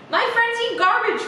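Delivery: put-on high, bright child calling out. A narrator's reading. Federica calling to her parents, high and eager. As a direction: call it up the stairs like an eager kid. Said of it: "Federica called".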